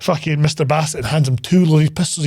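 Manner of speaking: usual speaking voice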